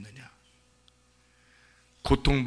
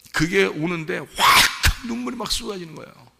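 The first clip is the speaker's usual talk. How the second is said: raised, loud sermon voice; fervent preaching; 7 semitones higher, 7 dB louder than usual. Acted. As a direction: preach it with burning conviction. Preaching rises to full force. (뜨겁게 외치는 설교)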